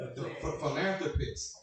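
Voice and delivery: ordinary talk, nothing marked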